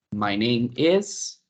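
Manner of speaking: slow, drawn-out model phrase for learners; slow model answer. Shown as a demonstration. Teacher modelling an English answer for Spanish-speaking students, slowly. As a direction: lay each word out slowly for the class.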